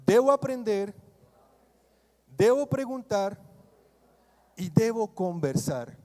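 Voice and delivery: loud, emphatic declaration for the congregation to repeat; preacher leading the church in repeating a vow; call and response. Shown as a demonstration. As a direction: proclaim each line so they say it back. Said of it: "Di conmigo".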